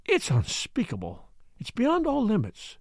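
a touch of indignant anger, rising high on the second phrase; flushed and indignant, understated; angry outburst. A narrator's reading. Daughter complaining to her father about her husband, angry. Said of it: "flushed angrily".

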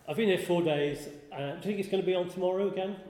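a hint of Manchester accent for the car park guy. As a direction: put on a mild Manchester accent, chummy. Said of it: "only he said it in a Manchester accent".

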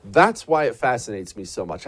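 ordinary talk

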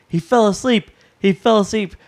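retold yelling. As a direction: shout it across the ring, half laughing. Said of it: "he's now yelling at Undertaker".

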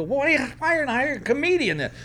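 high, incredulous question. Retold or imitated. Comic protesting to the organizer, high incredulous. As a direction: protest in disbelief. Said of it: "And I go".

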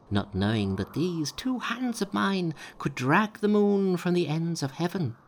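high, boastful monkey voice. A narrator's reading. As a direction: brag and dare him high.

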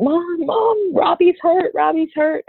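put-on high, frantic child's cry for mom; mock-panicked high child's voice; panicked child screaming. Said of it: "she just came in screaming".

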